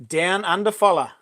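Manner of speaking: ordinary talk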